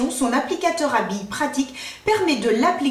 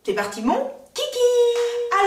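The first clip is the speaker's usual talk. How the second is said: catchphrase; 9 semitones higher, slower than usual. Acted. Kicking off the makeup test.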